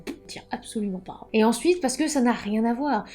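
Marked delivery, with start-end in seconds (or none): 0.1-1.3 a breathy whispered aside, under the breath. Real, not acted. Author confiding to viewers about bare-chested covers, whispered. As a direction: let it slip out as a secret confession.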